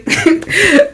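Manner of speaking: high breaking giggle; amused high chuckle; laugh. Real, not acted. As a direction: burst into a high laugh mid-sentence. Laughs amid telling.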